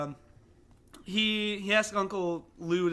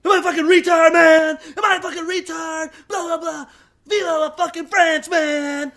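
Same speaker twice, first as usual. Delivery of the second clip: high, loud hammed-up shouting rant; mock Nick Cage rant; 8 semitones higher, 10 dB louder than usual. Host acting out the movie rant, shouting high. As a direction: scream it like a coked-up wild man. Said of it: "he's like".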